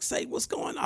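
high, light questioning voice; security asking what's going on, lightly high; security's voice. Retold or imitated.

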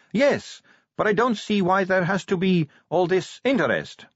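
high-pitched vampire recruit; Sally. A narrator's reading. Sally defends her application.

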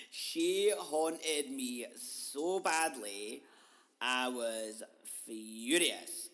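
loud, drawn-out comic indignation; comic outrage. Retold or imitated. Ranting to listeners about being haunted, loud comic outrage. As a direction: stretch each word out loudly in mock fury. Exclaims how badly she was haunted.

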